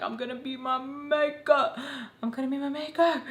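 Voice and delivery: high, whiny imitation of nervous self; mock nervous. Replaying own nervous thoughts, mock fretting.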